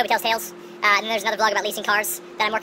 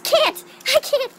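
exasperated squeal; 7 dB louder, much slower than usual. Real, not acted. Exasperated about error.

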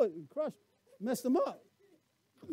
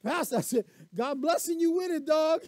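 mildly raised, eager imitation; a hint of Nate hyped up; lightly voiced excited helper; 5 semitones higher, 5 dB louder than usual. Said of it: "And then he get a little".

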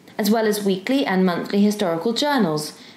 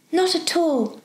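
put-on high, polite girlish voice; eager helpful girl; 8 semitones higher, slower than usual. Girl answering the professor's thanks, eager. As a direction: brush off thanks brightly, pitched high. Diana's voice in the story.

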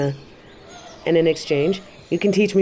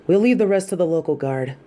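ordinary delivery

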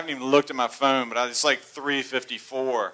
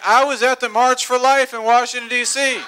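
excited; 9 semitones higher, 5 dB louder than usual. Real, not acted. Excitedly telling his wife Beverly.

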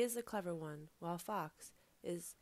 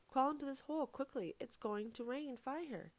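high-pitched, acted alarm; urgent warning; 6 semitones higher than usual. A narrator's reading. Guinea Pig tricks Fox into the hole, urgent.

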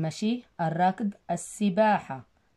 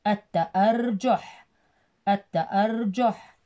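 التأرجح said slowly with the ل silent: at-ta-ar-juh; slowly blending the whole word; slow clear word; slower than usual. Shown as a demonstration. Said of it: "الشمسية تكتب ولا تلفظ".

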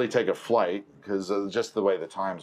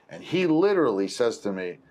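usual speaking voice

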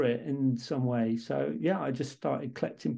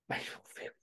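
breathy, hushed puzzled question; puzzled echo; 13 dB quieter, faster than usual. Retold or imitated. Colleague from Serbia echoing the request in hushed disbelief. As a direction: echo the words back in quiet disbelief.

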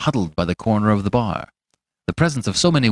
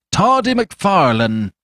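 higher, louder and slow, calling out the nickname; loud insistent call; 9 semitones higher, 5 dB louder, slower than usual. A narrator's reading. Fetz hailing the startled doctor, slow loud call. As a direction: drag the nickname out loud for all to hear. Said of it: "repeated Fetz".

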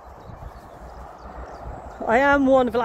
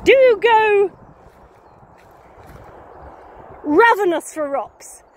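loud, high advert pitch; mock advert; 8 semitones higher, 4 dB louder, slower than usual. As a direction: sell it like an advert for a pebble support group. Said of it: "first line of the advert".

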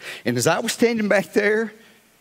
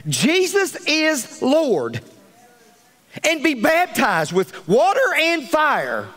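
slow, higher, drawn-out reading; solemn scripture reading; 7 semitones higher, much slower than usual. Acted. Pastor reading Romans to the church, slow and solemn. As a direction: lay each word down slowly.

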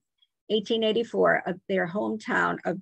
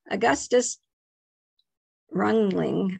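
Augustus Rungling in a light German accent; attempting a German pronunciation of the name; slower than usual. Shown as a demonstration. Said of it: "my German accent is not correct".

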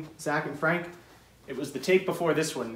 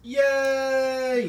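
cheering; 8 semitones higher than usual. Real, not acted. Cheering after finishing.

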